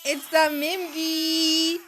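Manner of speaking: slow, stretched, a touch of fake crying; slow hammed-up wail; slow mock tearful. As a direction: draw it out tearfully. Drawn-out repetition.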